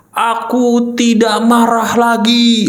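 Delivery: slow, drawn-out proclamation; exaggeratedly sustained claim; mock declaration. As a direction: stretch every word as you declare it. Mimicking a declaration to the world.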